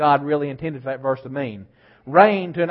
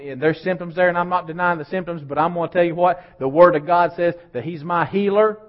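ordinary talk, nothing marked

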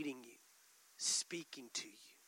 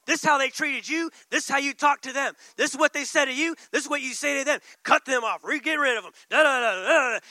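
voice of the flesh; 3 semitones higher, 9 dB louder than usual. Acted. Flesh egging the believer on, loud pushy goading. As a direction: goad them into payback. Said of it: "But the flesh is saying".

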